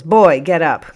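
loud, explosive order; sudden explosion. A narrator's reading. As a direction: burst out ordering the boy up. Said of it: "he suddenly explodes".

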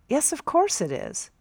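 lightly voiced captain. A narrator's reading. Captain insists, shifted voice.

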